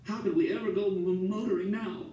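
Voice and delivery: high, broken, tearful question; a touch of tearful stammer; crying. A narrator's reading. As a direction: voice catching mid-word. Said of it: "She started crying again".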